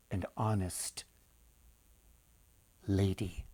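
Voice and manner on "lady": ordinary delivery, nothing marked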